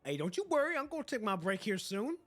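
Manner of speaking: high, loud cheery reply; playful shout. Real, not acted. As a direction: call back to chat. Answering a viewer message loudly.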